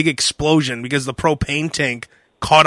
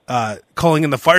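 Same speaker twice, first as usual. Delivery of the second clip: normal talking voice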